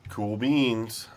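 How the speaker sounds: ordinary delivery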